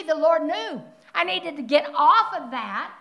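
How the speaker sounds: ordinary talk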